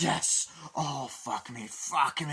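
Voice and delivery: loud, high wild moans; mock ecstasy, hammed up; ecstatic cries. Ecstatic character at festival.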